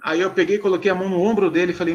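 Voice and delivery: usual speaking voice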